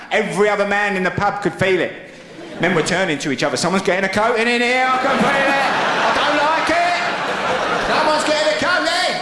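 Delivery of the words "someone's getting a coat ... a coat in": high, anxious pub blokes; a bloke sensing trouble, voice up high; nervous pub men. Retold or imitated. Pub men sensing a row, high nervous voices.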